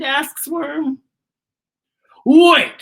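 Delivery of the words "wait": sudden loud, higher wait. A narrator's reading. Beetle interrupting the worms, loud.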